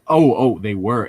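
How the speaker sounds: ordinary talk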